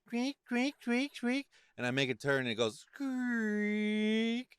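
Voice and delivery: high-pitched imitated squeak; squeaky truck noise. Truck owner imitating his squeaking front end for a friend, high squeak. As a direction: mimic a squeaking suspension. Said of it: "it's going squeak".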